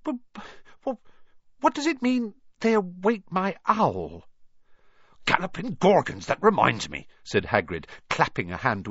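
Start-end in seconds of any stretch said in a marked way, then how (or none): 0.0-4.2 put-on high, hesitant boy's stammer; stuttering, puzzled question. A narrator's reading. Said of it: "he stammered". 5.2-7.1 higher, startled exclamation; hearty giant's oath of surprise; sudden remembering. Acted.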